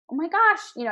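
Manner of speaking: high, loud, thrilled gasp; excited gush. Retold or imitated. Coach gushing to her client over progress, excited. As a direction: gush with delight over the news.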